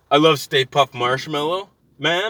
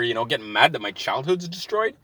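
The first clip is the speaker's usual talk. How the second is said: normal talking voice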